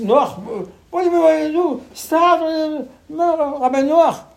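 high, loud panicked plea to Noach; panicked calling out. Retold or imitated. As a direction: beg Noach for help in panic. People crying out to Noah.

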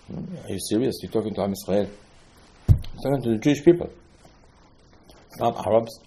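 ordinary talk

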